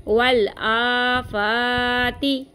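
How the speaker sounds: वल आफाती, stretching both aa and faa; lengthened vowels. Shown as a demonstration. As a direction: draw out the long vowels. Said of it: "आ और फा को दोनों को खींचेंगे".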